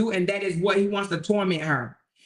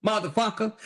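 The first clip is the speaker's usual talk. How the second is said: normal talking voice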